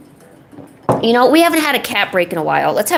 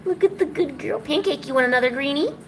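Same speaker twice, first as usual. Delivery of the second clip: higher, soft coaxing; 5 semitones higher, 6 dB quieter than usual. Real, not acted. Owner offers the cat another treat, high soft baby talk.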